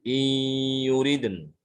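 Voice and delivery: in yuriden, long hum then closing on n; very slow chant, no i after yuriden; stopped without ya. Shown as a demonstration. Teacher showing how to stop, chanted slowly. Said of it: "Jadi bacanya".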